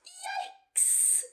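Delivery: a tiny shocked squeak; yikes. Acted. Betty seeing the brew pour over the floor, squeaked.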